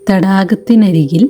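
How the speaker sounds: ordinary talk, nothing marked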